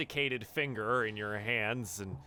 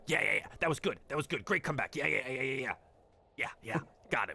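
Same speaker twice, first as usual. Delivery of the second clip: cheering madcaps; 3 semitones higher, much faster than usual. Acted. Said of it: "madcaps around him go".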